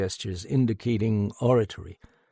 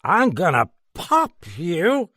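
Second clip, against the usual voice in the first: high, loud, slow, stress on pop; drunken threat; 8 semitones higher, 5 dB louder than usual. A narrator's reading. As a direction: threaten him sloppily.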